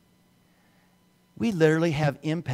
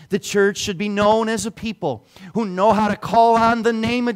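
louder, higher visionary preaching; full-force preaching; 10 semitones higher, 10 dB louder than usual. Acted. Pastor shares his vision of children coming in, preaching hard. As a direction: paint the vision with rising fervour.

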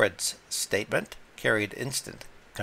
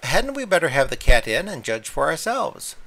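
lightly voiced society hostess, louder and higher; a raised, eager suggestion from the lady; higher lady's voice; 7 semitones higher, 8 dB louder than usual. A narrator's reading. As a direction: hostess proposing to fetch the cat, higher and louder. Lady Blemley suggests, narrated quote.

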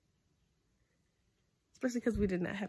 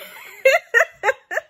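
high, bursting laughter; loud amused laugh; 15 dB louder than usual. Real, not acted. Laughs at card's sign-off.

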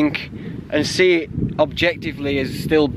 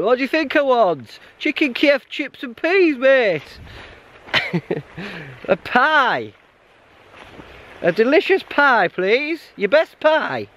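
comically high, pleading pub order; 8 semitones higher, slower than usual. Pretend. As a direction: beg for pie in a squeaky voice. Imagined hiker ordering at bar.

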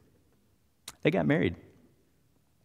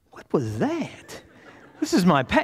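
acted bewilderment, pitched up; flabbergasted; 9 semitones higher than usual. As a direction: imitate a stunned lady. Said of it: "she's just flabbergasted".

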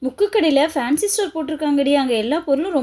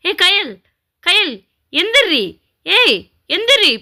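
higher, drawn-out yell to wake her; angry wake-up yell; 4 semitones higher, slower than usual. Acted. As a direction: stepmother losing patience, yelling the girl awake. Stepmother yelling the girl awake.

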